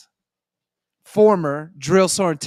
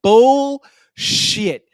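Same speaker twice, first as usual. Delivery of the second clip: mock sergeant yell, higher and louder; hammed-up sergeant calling it out; retold bark; 6 semitones higher, 9 dB louder than usual. Sergeant dismissing a soldier's excuse, mock loud bark. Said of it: "He was like".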